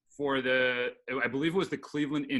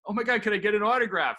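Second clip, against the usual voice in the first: high, louder, starstruck request; gushing fan; 7 semitones higher, 5 dB louder than usual. Retold or imitated. Young fan asking pitcher for autograph, gushing. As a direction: plead eagerly with your idol. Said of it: "And I go to him".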